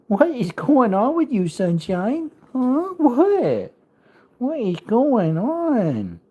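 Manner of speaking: high cooing voice for the puppy; high sing-song pet talk. Real, not acted. Baby talk to dog.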